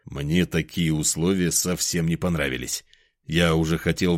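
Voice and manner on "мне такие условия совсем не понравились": ordinary delivery, nothing marked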